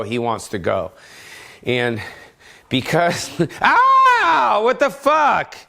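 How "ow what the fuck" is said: high yelp of pain with a laugh; sudden high startled ow; surprised yelp. Real, not acted. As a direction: yelp as the dog bites. Dog bites him.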